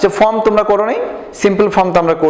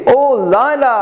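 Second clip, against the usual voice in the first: mother calling; 7 semitones higher, slower than usual. Acted. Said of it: "তার মা বলে".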